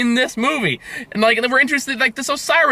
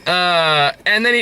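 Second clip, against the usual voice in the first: ordinary talk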